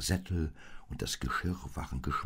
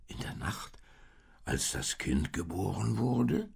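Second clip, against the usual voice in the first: mildly puzzled question; a cow gently wondering, voice rising at the end; a touch of surprise; 4 semitones higher than usual. A narrator's reading. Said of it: "fragte die Kuh verwundert".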